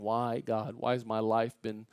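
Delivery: very soft, low plea to God; tearful prayer. Retold or imitated. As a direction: pray it quietly, as if retelling a tearful moment. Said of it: "when I'm sitting there crying".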